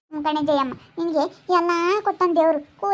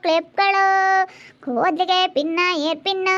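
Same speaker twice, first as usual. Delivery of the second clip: higher, drawn-out sing-song vendor call; hawker's street cry; 5 semitones higher, slower than usual. Retold or imitated. Village woman imitating the hair-pin hawker's sing-song cry. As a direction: sing out your wares like a village peddler.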